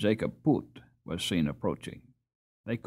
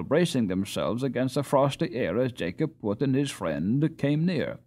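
usual speaking voice

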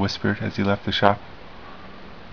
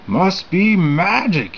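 puzzled shopkeeper; 8 semitones higher, slower than usual. Acted. Maxwell puzzled.

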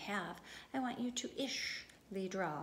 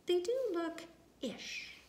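higher, slow, wondering; gentle discovery; 9 semitones higher, 3 dB louder, slower than usual. Acted. Ramon seeing the drawings anew, slow and wondering.

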